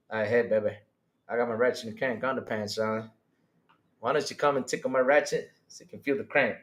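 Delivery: low, soft come-on; mock seduction. Streamer spoofing an underpants come-on, mock seductive. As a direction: play a hammed-up sweet-talker, voice dropped.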